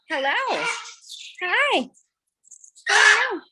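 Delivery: high, bright pet greeting; high greeting to bird. Real, not acted.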